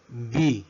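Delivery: letter B pronounced "bee"; English letter B. Shown as a demonstration. Teacher modelling the English alphabet, B as "bee". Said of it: "cómo la pronunciamos en inglés".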